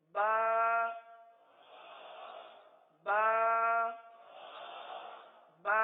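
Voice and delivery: با loud, long and level, "baa"; drawn-out, voiced "baa" for pupils to copy; model pronunciation of ba. Shown as a demonstration. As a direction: hold با on an even note. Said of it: "پہلے آپ ادائیگی سن لیجیے".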